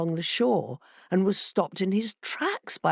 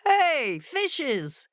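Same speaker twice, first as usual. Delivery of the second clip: much higher, calling fox; fox crying out; 9 semitones higher than usual. A narrator's reading. Fox calling to the fishes beneath, crying out. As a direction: call out sharply. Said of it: "cried the fox".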